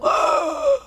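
sharp high frightened gasp; high startled gasp; alarmed gasp. Retold or imitated. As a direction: gasp in shock. Waking up alarmed.

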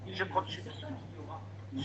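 slow, unvoiced whisper; despairing whisper. Sister-in-law asking who she can rely on, slow whisper. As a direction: breathe the question out slowly, lost.

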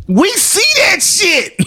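high, slow, half-laughing yell; hammed-up outrage, voice cracking high; mock outraged shout. Mock outrage about partner staring.

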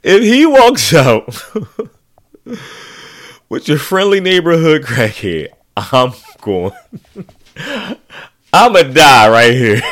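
loud, high laughing build-up; laughing hard. Real, not acted. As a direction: crack up at the thought. Laughing at imagined scene.

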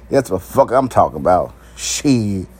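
normal talking voice

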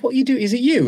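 put-on high, delighted disbelief at seeing an old mate; surprised recognition. Old teammate recognises him, surprised.

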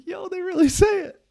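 high, loud, amazed "Yo, they really say it."; can't believe his ears. Retold or imitated. Realising the townspeople really say ahoy, amazed.

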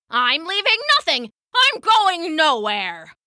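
loud, defiant refusal, slowing down; stubborn angry old woman. Acted. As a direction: dig in your heels furiously.

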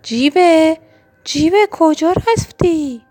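high, loud calling out; shouting. Acted. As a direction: call out for the lost friend. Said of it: "اکسیژن فریاد زد".

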